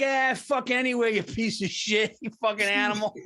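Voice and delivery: hammed-up scornful server; contemptuous waitress. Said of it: "She might as well have been like".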